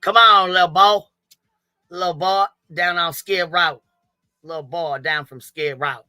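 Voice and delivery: put-on mocking accent with swallowed word endings; Betty. Streamer mimicking a rival for her chat, mocking fake accent. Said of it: "gotta get our Betty accent on".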